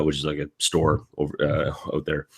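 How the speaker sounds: ordinary delivery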